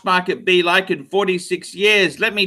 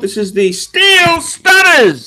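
high, loud announcement of the name; a showman unveiling his prize; proud showcase; 9 semitones higher, 6 dB louder than usual. Acted. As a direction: proudly present the collection. Showing off his watch collection.

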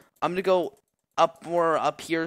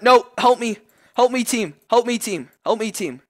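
high, loud plea fading down; panicked cries to the team; panicked call for help; 8 semitones higher, 8 dB louder than usual. Real, not acted. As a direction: beg the team for help, panicking then sinking.

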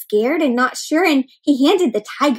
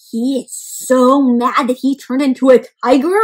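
ordinary talk, nothing marked

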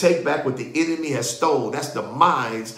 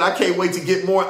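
ordinary talk

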